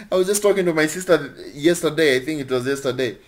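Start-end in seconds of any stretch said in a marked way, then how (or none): none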